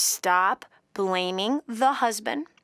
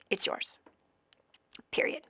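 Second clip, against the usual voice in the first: soft, unvoiced final word; host whispering the last word to the caller; whispered verdict; 10 dB quieter than usual. Real, not acted. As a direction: drop to a whisper to close it.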